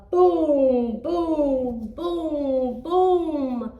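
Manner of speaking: loud, slow booming; booming footsteps. Acted. Giant's footsteps coming.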